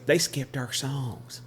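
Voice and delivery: mock-aggrieved twang, stretched out; twangy tattling. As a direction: imitate the girl tattling in a drawn-out twang. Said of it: "just like that".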